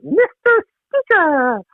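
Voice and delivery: drawn-out, high-pitched mocking cry; mock scream. Dad imitating daughter mocking the lady Liberal, high mock scream. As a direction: imitate a heckler's long, high squeal. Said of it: "her scream out".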